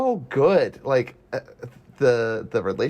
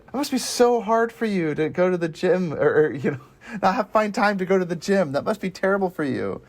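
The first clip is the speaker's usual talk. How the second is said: higher, sugary mock concern; mocking fake sympathy; 6 semitones higher than usual. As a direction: parody the mothers' fake pity. Mocking the stuck-up mothers.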